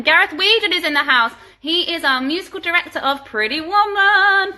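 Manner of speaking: loud, high shout-out; big hype introduction for a colleague; excited announcement. Real, not acted. Announcing a guest in the audience.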